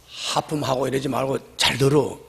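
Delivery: ordinary talk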